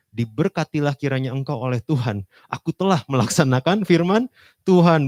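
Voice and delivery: Saul's boast belted out high and loud; proud Saul. Acted. Saul boasting to Samuel, loud and proud. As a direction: play Saul crowing over his success.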